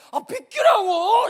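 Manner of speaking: loud, slow exasperated yell; annoyed shout. Acted. Girl fed up with boys' candy, angry shout. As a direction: shove them off with a drawn-out yell.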